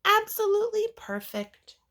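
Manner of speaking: high, fast, loving voice; reassuring mother. Acted. Mother telling her daughter the name fits her.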